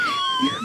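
helpless shrieking laugh; high squealing laugh. Real, not acted. Cracking up at own line.

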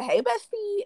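high, sweet mock "Hey, girl"; sugary fake greeting. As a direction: chirp it up like a fake friend.

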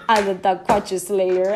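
laughing through a mock boast, slowed; laughing brag. To her friend about the neighbor, laughing mock boast. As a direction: crow it while cracking up.

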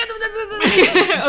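high, laughing eager rush; excited laughing. Retold or imitated. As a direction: jump in high and giggly. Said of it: "dan ging ik".

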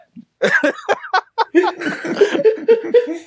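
long, loud-pitched peals of laughter; high laughter. Real, not acted. Reader cracking up at her own retelling, high laughter.

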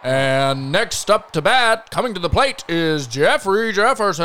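slow, booming stadium announcer. Acted. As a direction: stretch it out like a ballpark PA. Sports announcer intro.

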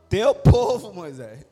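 loud accusing "teu povo", then dropping low on Moisés; God blames Moses, half-joking; God's mock scolding. God hands sinful people back to Moses, mock accusing. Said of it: "ele fala assim".

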